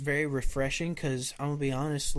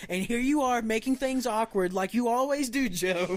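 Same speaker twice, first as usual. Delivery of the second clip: mock scolding; 8 semitones higher than usual. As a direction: scold him in hammed-up outrage.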